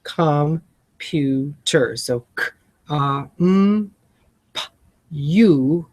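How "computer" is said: "computer" stretched out very slowly. Shown as a demonstration. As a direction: draw out "computer" so every sound is clear. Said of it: "And then computer".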